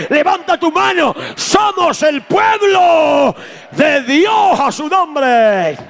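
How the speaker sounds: high, slow shouted praise; pulpit climax. Acted. Preacher rallying the congregation, high and slow shouting. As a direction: lift the whole church with it.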